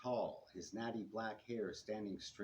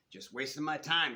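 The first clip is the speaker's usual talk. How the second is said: loud, craggy complaint; a hint of a craggy old man; craggy deep voice; 5 semitones higher, 8 dB louder than usual. A narrator's reading. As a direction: grumble it out like a cranky street man. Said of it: "his craggy voice deeper than the subway sub-sewers".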